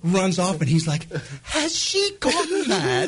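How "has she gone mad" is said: very high, drawn-out fake British cry; terrible British accent. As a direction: ham up an aghast Brit, high and slow. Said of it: "talking in the worst British accent".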